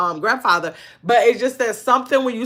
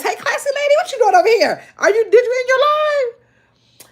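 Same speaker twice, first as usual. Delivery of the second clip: high playful greeting; 13 semitones higher, 3 dB louder than usual. Real, not acted. Greeting a viewer in chat.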